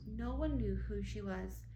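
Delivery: usual speaking voice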